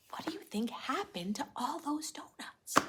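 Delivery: hushed secret whisper. Acted. Teacher to young listeners, whispering to build suspense. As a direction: lean in and whisper the question.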